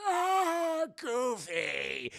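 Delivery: menacing sing-song greeting; boss greeting. Retold or imitated. Boss spotting Goofy walking out. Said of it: "He's like".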